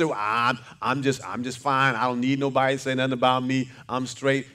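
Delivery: low, fast, offhand brush-off voice; mock tough denial. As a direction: mimic someone insisting they need nothing. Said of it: "Some say".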